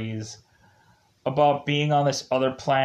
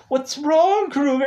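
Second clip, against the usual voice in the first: mock worried teammates, much higher; concerned Rangers; 13 semitones higher, 4 dB louder than usual. Teammates asking Kruger what's wrong, pitched high. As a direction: chirp the question up high.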